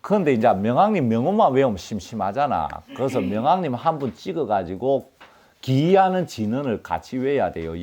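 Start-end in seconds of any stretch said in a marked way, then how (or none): none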